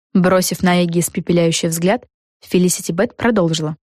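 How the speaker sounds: usual speaking voice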